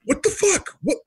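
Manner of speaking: Jay snapping back at Josh, incredulous; incredulous outburst. Retold or imitated. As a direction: fire it back in disbelief. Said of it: "Jay's like".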